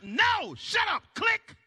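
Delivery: shouting the friend down before hanging up. Acted. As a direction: slam the call shut.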